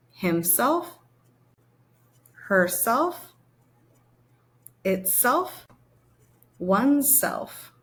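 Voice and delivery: each word alone, slow and clear with a rising tune; teacher sounding out words for the class to echo; pronunciation drill. Shown as a demonstration. Teacher models each pronoun, careful and drawn out. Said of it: "repeat after me to practice your pronunciation".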